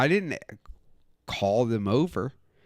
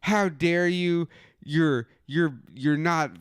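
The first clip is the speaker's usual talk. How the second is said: mock outrage; 5 semitones higher, much slower than usual. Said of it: "And they were like".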